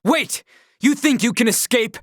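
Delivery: high shout to stop him; shouting after him. Acted. Hero yells at the fleeing enemy, high shout.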